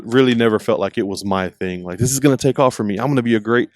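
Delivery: ordinary delivery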